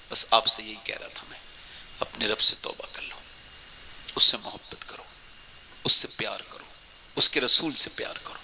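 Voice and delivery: low whispered exhortation. Real, not acted. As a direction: urge them gently under the breath. Soft closing exhortation. (دھیمی سرگوشی میں نصیحت)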